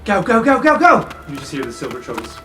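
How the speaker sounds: normal talking voice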